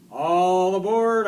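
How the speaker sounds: loud, high, drawn-out driver's call. Acted. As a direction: call slowly to the whole bus. Bus driver calling to passengers.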